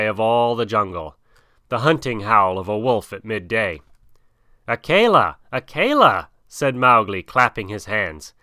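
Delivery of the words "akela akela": raised, louder glad calling of a name; joyful call. Acted. Mowgli calls joyfully to his returning wolf friend, raised and loud. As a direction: call out with delight, clapping.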